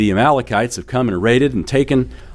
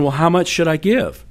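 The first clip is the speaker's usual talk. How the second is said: lightly imitated young daughter, pitched higher; higher-pitched child's question; child asking; 7 semitones higher than usual. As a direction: ask like an eager little kid. Voicing his young daughter's question.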